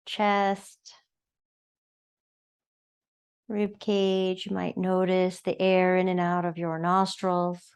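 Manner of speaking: unhurried, soothing yoga-class guidance; guided meditation. Acted. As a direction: guide them gently into noticing the breath. Leads breathing meditation.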